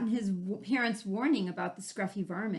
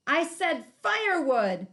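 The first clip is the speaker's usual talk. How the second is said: higher, louder yelp, the second half slow; 5 semitones higher, 7 dB louder, slower than usual. Acted. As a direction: yelp in pain at the thorns. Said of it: "he yelped".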